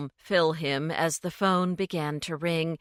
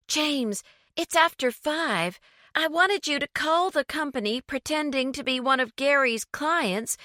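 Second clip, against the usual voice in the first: higher, fast and urgent; urgent higher pleading; alarmed urgency; 9 semitones higher than usual. A narrator's reading. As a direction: rush him, she's in a panic. Lucy alarmed, urging James.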